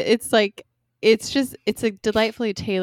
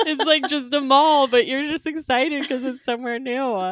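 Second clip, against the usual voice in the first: laughing; 5 semitones higher than usual. Real, not acted. Laughing comment after mock.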